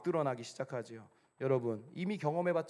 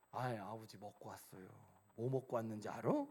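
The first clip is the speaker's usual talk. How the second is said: low, soft, imagined answer; gentle reply; 4 semitones lower, 8 dB quieter than usual. Pretend. Son imagining a kinder answer to father, gentle. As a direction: say it softly, as you wish you had.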